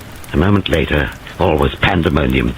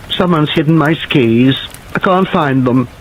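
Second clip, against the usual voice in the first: put-on childish complaint; 10 semitones higher than usual. Madman turns childish, petulant.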